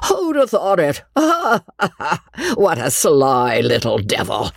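high chuckle settling into an amused mutter; gleeful old squire, laughing; muttering, chuckling with pleasure. A narrator's reading. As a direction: chuckle to yourself like a delighted old rogue. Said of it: "he muttered, chuckling with pleasure".

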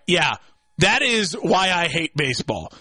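usual speaking voice

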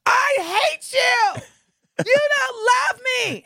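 high-pitched wailing yell, drawn out; shrieky hammed-up phone argument; mock lovers' quarrel. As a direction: imitate a hysterical girlfriend mid-fight. Acts out woman's loud argument.

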